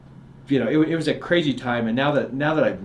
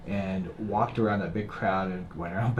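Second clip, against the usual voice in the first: usual speaking voice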